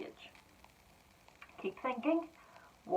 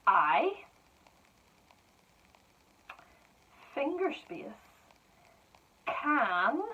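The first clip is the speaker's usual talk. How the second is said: slow dictation; slower than usual. Shown as a demonstration. Teacher dictating to pupils, words spaced apart. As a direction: spell it out for children, word by word.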